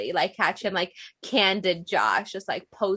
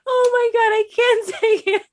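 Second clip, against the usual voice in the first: high, loud, laughing oh my God; laughing disbelief; 13 semitones higher, 7 dB louder than usual. Real, not acted. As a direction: crack up while you say it. Laughing at friend's story.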